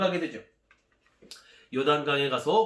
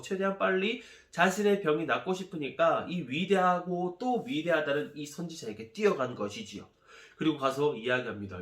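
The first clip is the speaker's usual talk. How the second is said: plain reading voice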